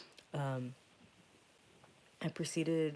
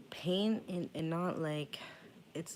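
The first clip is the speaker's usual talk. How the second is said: ordinary talk, nothing marked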